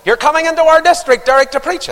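high, loud mock churchman; pompous caller. Church caller phoning the preacher, pompous. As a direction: mimic the pushy caller. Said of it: "Fella rang me up".

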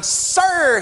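high, loud desperate "Sir"; frustrated cry. Acted. Father breaking in on Jesus, high frustrated cry. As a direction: cry out to be heard. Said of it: "hear the frustration of the man".

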